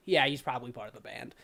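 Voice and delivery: low, quick bouncer brush-off. Acted. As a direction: wave him in like a bored bouncer. GM voices bouncer, fast low.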